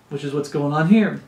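normal talking voice

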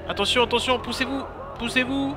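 high, urgent warning shout; cri d'avertissement. Real, not acted. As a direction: call out in panic to clear the way. Crie aux passants en pleine fuite. (crier pour qu'on s'écarte, affolé)

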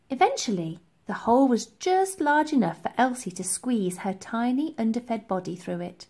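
plain reading voice

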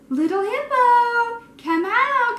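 high, loud, stretched call across the grass. Acted. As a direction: call out, searching for the lost little one. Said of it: "they called".

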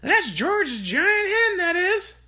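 put-on high, slow cackling old woman's voice; Grandma voice. A narrator's reading. Grandma's voice in the story.

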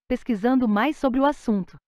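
normal talking voice

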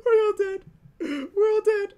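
very high, strained little wail; high-pitched mock whimper; squeaked doom. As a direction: squeal it in falsetto despair. Squeaked doom during scare.

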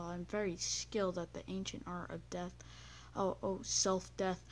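ordinary talk, nothing marked

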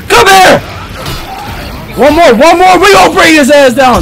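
loud, high battle yelling; battle shout. Real, not acted. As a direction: shout to bring him down. Fight yelling.

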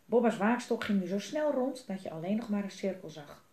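ordinary delivery

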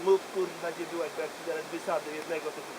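low, fast, menacing husband; threatening husband. Acted. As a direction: growl it low and fast like a drunk angry husband. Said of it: "Andrzej do niej staje i mówi".

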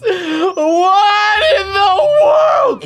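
very high, loud, drawn-out shriek; screaming disbelief. Real, not acted. Seeing the dolphins with his face, screaming. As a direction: stretch it into a laughing scream.

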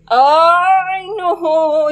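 high, loud, drawn-out wail; long high cry of protest; wailed dismay. Real, not acted. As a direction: wail it in distress. Dismayed by the game.

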